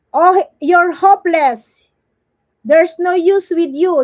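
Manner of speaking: loud, emphatic put-down; emphatic insult. Acted. Streamer reading out what the insults mean, loud and emphatic. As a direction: belt out the insult. Delivers translated insults at full voice.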